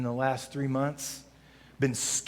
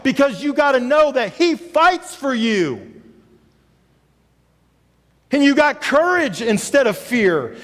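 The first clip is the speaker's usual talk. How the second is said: louder, higher preaching to the congregation; full-force preaching; 11 semitones higher, 11 dB louder than usual. Acted. Pastor rallying congregation to serve, preaching hard. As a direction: pastor urging the church to go serve.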